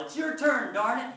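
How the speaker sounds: loud, high exasperated yell. A narrator's reading. Child narrator yells at a bossy brother, exasperated yell. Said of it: "when I yell".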